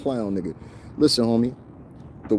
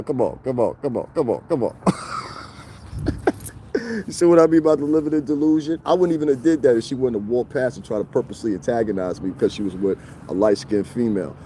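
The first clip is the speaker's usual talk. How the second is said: ordinary talk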